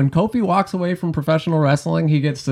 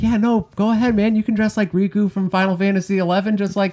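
higher-pitched, lightly put-on boss voice; mock company bosses; 9 semitones higher than usual. Bosses humouring a wrestler who earns money, lightly mocked higher voice. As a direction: imitate bosses egging on a star, pitched up. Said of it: "But it, they're like".

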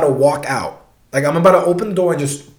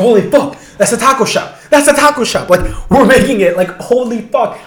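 loud, high, excited retelling; acting out a burst of relieved excitement; elated recognition; 6 semitones higher, 4 dB louder than usual. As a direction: exclaim it like home is suddenly in sight. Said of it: "trying to get this happiness".

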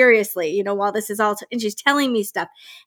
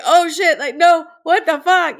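louder, slow, alarmed curse; hammed-up alarm, slowed and a little louder; mock freak-out; 5 semitones higher, 5 dB louder, slower than usual. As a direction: replay the panic for laughs. Retelling her freaked-out reaction.

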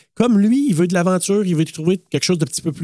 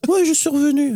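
character quote; 13 semitones higher than usual. Retold or imitated. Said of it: "elle dit".

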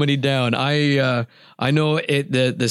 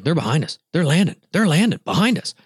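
urgent call; 3 semitones higher, faster than usual. Retold or imitated. Scotty calling out landing geese.

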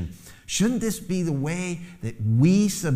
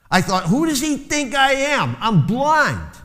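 angry outburst; 11 semitones higher, 5 dB louder than usual. Retold or imitated. Retold anger of blind son.

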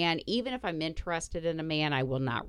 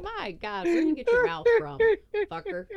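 mock shocked. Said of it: "I'm like".

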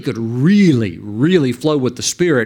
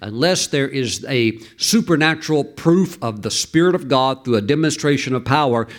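ordinary talk, nothing marked

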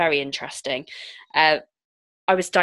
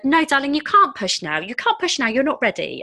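higher and louder, firmly soothing midwife; patient midwife; 8 semitones higher, 5 dB louder than usual. Retold or imitated. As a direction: gently overrule her, pitched up. Midwife refuses to let her push.

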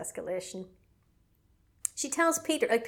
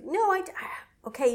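exasperated sigh; 10 semitones higher, 3 dB louder than usual. Retold or imitated.

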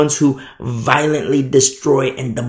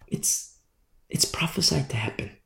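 soft, whispered prophecy; whispered warning; 2 semitones higher, 8 dB quieter than usual. Real, not acted. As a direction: drop to a whisper to warn. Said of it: "dramatic hushed warning".

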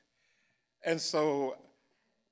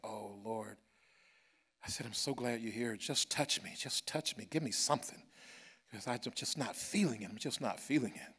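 soft, intimate plea to the Lord; hushed prayer; 5 semitones lower, 9 dB quieter than usual. Retold or imitated. Pastor in the car quietly pleading with Jesus, hushed. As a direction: lean in and pray quietly, close.

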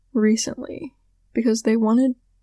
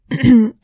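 throat clearing; 9 dB louder than usual. Real, not acted. Clears throat mid-sentence.

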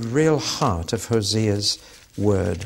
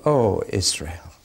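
normal talking voice

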